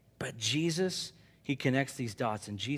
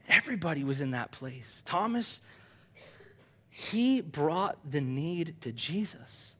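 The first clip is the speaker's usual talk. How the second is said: usual speaking voice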